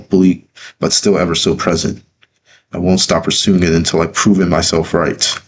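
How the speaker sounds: usual speaking voice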